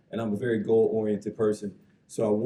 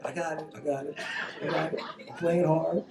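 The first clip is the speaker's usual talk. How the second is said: mock defensive; 6 semitones higher than usual. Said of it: "I'm like".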